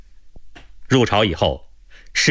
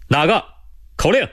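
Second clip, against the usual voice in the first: sharp, high-pitched sentry challenge; sentry's challenge; 9 semitones higher than usual. A narrator's reading. Sentry to unknown night visitors, challenging sharply. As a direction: challenge them in a raised pitch, demanding an answer. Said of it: "远远的就传来了哨兵的喝问声".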